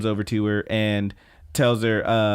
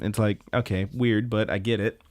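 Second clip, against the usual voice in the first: ordinary talk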